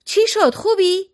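high, urgent shouted question; alarmed shout. A narrator's reading. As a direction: shout it high, checking someone is unhurt. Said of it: "مدیر دوید بیرون و فریاد زد". (فریاد نگران با صدای زیر)

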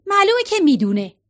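put-on girl snapping at her mother's question, defensive retort. A narrator's reading. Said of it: "مگی به مادرش پرید و گفت".

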